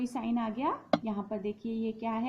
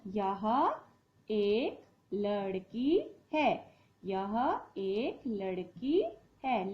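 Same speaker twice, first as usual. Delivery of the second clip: यह एक लड़की है said very slowly; slow dictation; much slower than usual. Shown as a demonstration. Teacher writes the sentence, slowly dictating. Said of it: "तो यहाँ हम लिखेंगे यह".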